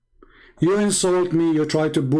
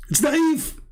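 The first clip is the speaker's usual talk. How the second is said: mock whiny objector; 11 semitones higher than usual. Mocking the opponent's objection.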